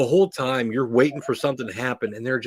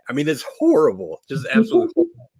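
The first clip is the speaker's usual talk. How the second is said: excited; 5 semitones higher, 4 dB louder, faster than usual. Real, not acted. Mock outrage at singing.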